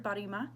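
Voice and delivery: barima in three even syllables, pure vowels; ba-ri-ma, clean vowels, tapped R. Shown as a demonstration. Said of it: "very pure vowels on each of those three syllables".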